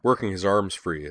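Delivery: usual speaking voice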